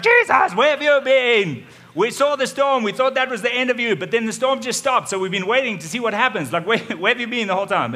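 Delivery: high, loud and breathless with relief; loud eager villagers calling out; excited crowd calling. Acted. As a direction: shout it out like a worried crowd finally seeing him. Crowd greets returning Jesus excitedly.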